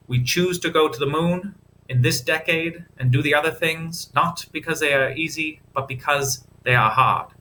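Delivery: a hint of Kennedy's Boston accent, oratorical. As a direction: quote JFK in a light Boston Kennedy accent. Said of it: "That Kennedy accent".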